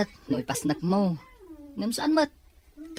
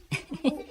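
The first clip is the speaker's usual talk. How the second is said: short giggle; 9 semitones higher, 4 dB quieter than usual. Acted. Friend laughs at teasing.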